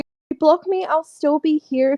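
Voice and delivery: high, mock-caring voice; mock sincere voice. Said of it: "And then Colson says".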